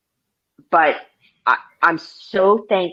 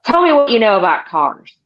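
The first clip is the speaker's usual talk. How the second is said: imitating a demanding old salesman, higher pitched; mock interrogation, pitched up high; gruff-boss style quizzing; 6 semitones higher, 4 dB louder than usual. Veteran salesman grilling the new kid, mock demanding.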